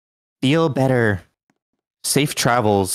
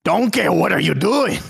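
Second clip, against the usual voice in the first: put-on higher ogre voice with shaky Scottish accent; Shrek; 8 semitones higher than usual. Streamer doing the ogre on request, Scottish-Irish impression. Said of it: "Can you do Shrek?".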